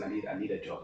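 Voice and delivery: soft, breathy plea; almost whisper the request; mock whisper. Begging the radio station for a job, whispered.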